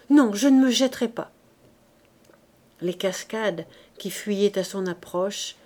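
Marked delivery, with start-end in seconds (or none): 0.1-1.3 high, loud panicked repeat of "Non, je ne me jetterai pas"; cling to the refusal in panic; terrified refusal again. Acted. Coupeau repeating his refusal, still terrified. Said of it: "Et il bégayait, les dents claquant d'épouvante".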